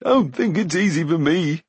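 high, whining self-pity; wheedling high voice; plaintive Glue. A narrator's reading. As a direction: plead plaintively for sympathy. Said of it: "he added plaintively".

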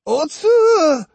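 high, loud scream of a name; piercing scream. A narrator's reading. Old woman chasing Otsu, screaming her name. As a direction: scream it across the river. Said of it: "she screamed, in a voice that might have parted the waters".

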